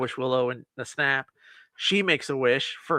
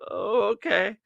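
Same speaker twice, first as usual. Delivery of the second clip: higher-pitched, hesitant agreement; uneasy acceptance; 6 semitones higher, slower than usual. Retold or imitated. Boy answering the girl who barged in, uneasily agreeing. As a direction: go along with it, raised and unsure. Boy's taken-aback reply to her.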